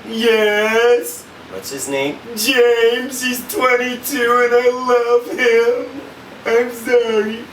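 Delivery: high, loud mock confession; husband imitating his wife confessing; mimicking wife. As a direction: play the wife blurting out her affair. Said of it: "I'm like".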